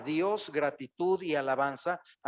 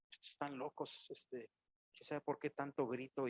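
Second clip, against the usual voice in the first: disapproving murmur; 10 dB quieter than usual. Retold or imitated. Critics disapproving of loud worship.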